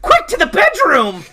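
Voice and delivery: high, loud rallying cry; urgent shout. Acted. Velma rushes Jerry away from the monsters, shouting urgently. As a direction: shout it as an escape order.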